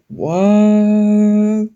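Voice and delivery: drawn-out what. Pretend. Repeated confused what takes.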